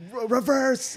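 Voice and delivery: high-pitched, stammered mock panic; panicked order. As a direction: urge the driver in retold alarm. Retold panic at trunk popping.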